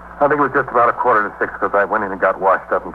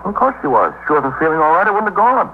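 defensive protest; 10 semitones higher than usual. Acted. Husband insists under police questioning.

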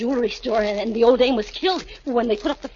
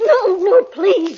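high, loud, panicked pleading; terrified begging; 8 semitones higher, 8 dB louder, much slower than usual. Acted. Kitty begging Nicky not to kill her. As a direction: beg for your life.

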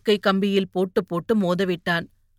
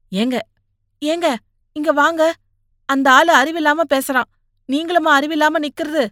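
higher, raised nagging voice; lightly voiced scolding wife; 6 semitones higher, 3 dB louder than usual. A narrator's reading. Wife pulling husband from the fight, scolding. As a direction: call him back with annoyance.

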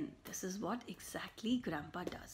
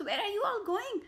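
higher, louder, puzzled; surprised call; 10 semitones higher, 4 dB louder than usual. Acted. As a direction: call out to them as they run by. Neighbour calls to runners.

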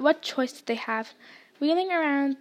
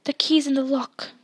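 usual speaking voice

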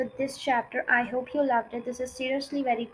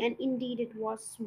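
usual speaking voice

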